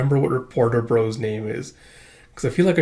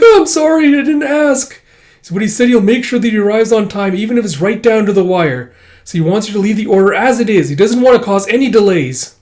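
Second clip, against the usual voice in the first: flustered apology; 8 semitones higher, 7 dB louder than usual. Acted.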